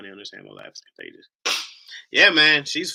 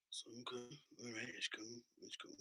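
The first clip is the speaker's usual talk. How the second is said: barely audible whisper; hushed mock-hurt; 2 semitones higher, 23 dB quieter than usual. Husband consoles himself after wife's insult, hushed whisper. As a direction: whisper it to yourself as if wounded.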